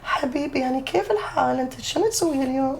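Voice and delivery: high, soft, fast Lebanese Arabic. Retold or imitated. Showing his friend how Lebanese sounds, soft and polite. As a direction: purr it politely, light and sweet. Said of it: "it's so polite and sexy".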